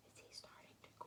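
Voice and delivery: fast, hushed excited whisper; awed whisper. Real, not acted. Teacher whispering excitedly to children. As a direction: breathe it in wonder. Gasp then hushed wonder.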